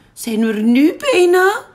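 put-on high, eager kid-like frog voice; a small frog asking yet again; impatient little frog. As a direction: whine the question up high. Frog pestering on long trip.

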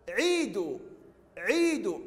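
عيدوا with the first ii held long; long ii vowel. Shown as a demonstration. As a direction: stretch the vowel after the ayn in عيدوا. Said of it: "العين حرف ممدود وبعده ممدود".

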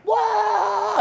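loud, high startled cry; startled shout; surprised whoa. Retold or imitated. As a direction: yelp in surprise. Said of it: "And I'm like".

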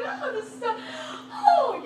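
high, laughing exclamation; thrilled squeal of joy; overjoyed. Retold or imitated. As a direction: gasp with laughing delight. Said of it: "I'm just like".

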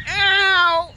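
sudden pained yelp; yelping ow in pretend pain; yell. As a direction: cry out ow as if hurt. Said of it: "why'd you yell?".

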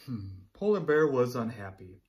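plain reading voice

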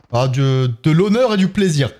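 usual speaking voice

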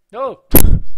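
sudden high, loud "no"; startled cry. Real, not acted. Player jolted as something pops up in the game, startled. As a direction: yelp it in real shock.

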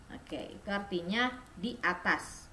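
normal talking voice

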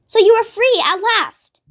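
high, drawn-out exclamation of joy; excited cry. A narrator's reading. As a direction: rejoice for a friend, slow and high. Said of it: "Matilda cried".

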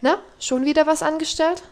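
light, high teasing question; cheerful. Acted. Servant cheerfully teasing Heidi, high and jolly. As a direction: tease her cheerfully. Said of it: "fragte Sebastian lustig".

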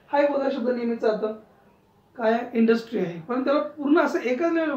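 normal talking voice

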